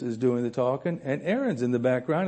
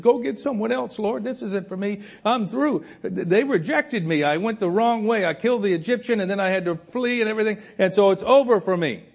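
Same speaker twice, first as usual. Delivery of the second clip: much higher, pleading excuse voice; self-pitying Moses; 9 semitones higher, 3 dB louder than usual. Retold or imitated. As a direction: beg off the job, sure you're finished. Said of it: "he said".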